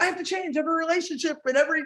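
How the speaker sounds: high, rushed mock panic; mock overwhelmed. Coach voicing someone overwhelmed by change, mock panicked and rushed. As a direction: rattle it off at a high pitch, as if overwhelmed. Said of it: "let's not overwhelm ourselves and be like".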